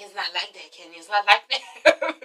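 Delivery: soft protest. Retold or imitated. Matt quietly pleading with Kenya. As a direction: murmur it low. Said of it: "He's like".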